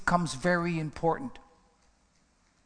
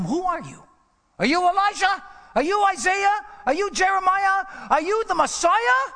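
higher, louder pressing questions; questioning crowd; 11 semitones higher, 5 dB louder than usual. Retold or imitated. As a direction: fire the questions at him like a crowd. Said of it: "when they asked him".

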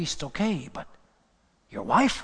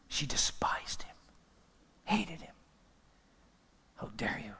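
hushed whisper; 8 dB quieter than usual. Acted. Hushed retelling of Michal's scorn.

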